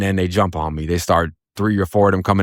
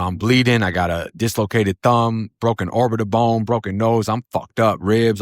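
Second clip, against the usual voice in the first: ordinary talk, nothing marked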